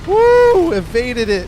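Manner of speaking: very high, loud victory whoop; high loud cheer after a narrow escape; triumphant whoop. Real, not acted. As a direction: celebrate the dodge at the top of your voice. Dodging the attack.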